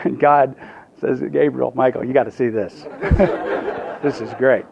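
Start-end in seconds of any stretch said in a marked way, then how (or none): 1.2-4.7 chuckling, lower-pitched aside, acted; amused God. God calling angels over to see, amused laughing. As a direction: let mock amusement break into laughter.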